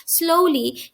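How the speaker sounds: usual speaking voice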